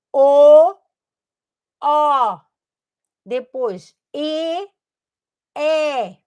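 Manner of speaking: "O" belted out loud and open, then "E" said slowly; vowels shown clearly. Shown as a demonstration. Teacher to new students, showing how each vowel is articulated, loud and slow. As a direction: sound out "O" big and loud, then a drawn-out "E". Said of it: "começa a diferenciar a questão articulatória".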